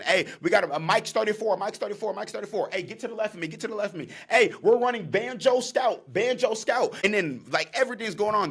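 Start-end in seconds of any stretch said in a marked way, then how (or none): 0.0-6.9 high, urgent play calls at the line; quarterback calls. Retold or imitated. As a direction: call the play out to your team. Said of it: "the quarterback is signaling".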